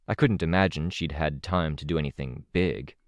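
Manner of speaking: plain reading voice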